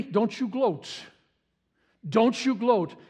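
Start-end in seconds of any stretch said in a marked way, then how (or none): none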